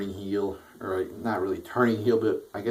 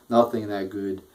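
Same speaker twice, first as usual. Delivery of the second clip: usual speaking voice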